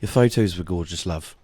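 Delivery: low, soft proper British accent. Shown as a demonstration. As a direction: echo a refined British compliment. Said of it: "like, the proper".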